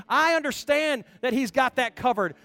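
full-force preaching. Acted. Preacher urging the congregation to know God, fervent. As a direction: lift the room with rising fervour.

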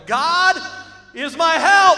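God is my help shouted very loud and high; Jesus shouting God is my help at the tomb. Acted. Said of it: "this is what he was shouting".

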